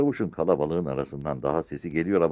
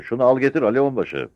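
raised, high commanding voice; shouted order; 9 semitones higher, 6 dB louder than usual. A narrator's reading. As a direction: call out an order to a subordinate. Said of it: "komut verir gibi bağırdı". (emir verir gibi yüksek sesle)

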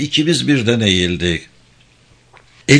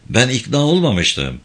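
ordinary delivery, nothing marked

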